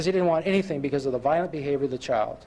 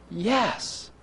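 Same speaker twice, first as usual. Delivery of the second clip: usual speaking voice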